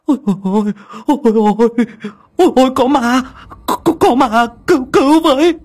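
high, loud panicked stutter for help; a man who has just seen a ghost, screaming in terror; terrified stammering cry. Acted. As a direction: shriek for help, tripping over the words. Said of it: "miệng lắp ba lắp bắp".

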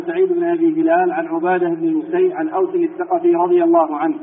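measured reading aloud of a chain of narrators; formal hadith recitation. Acted. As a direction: read the hadith out clearly to the teacher. Student reads hadith text aloud. (قراءة الحديث بصوت جهوري)

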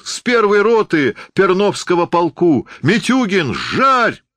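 high, ringing heckle; shouted encouragement. A narrator's reading. Spectator cheers on a soldier he knows, shouting. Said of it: "крикнул кто-то за мною".

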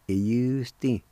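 i yus din, syllables run together as one word; blended demo. Shown as a demonstration. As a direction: join i yus din smoothly. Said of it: "And together".

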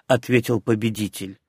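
plain reading voice

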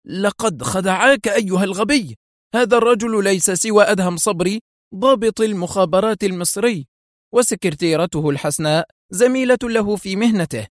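high, strained fury through teeth; through gritted teeth. A narrator's reading. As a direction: seethe at the fool who was tricked. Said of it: "يضغط على أسنانه غضبا". (غضب مكتوم من بين الأسنان يوبخ جوزيف)

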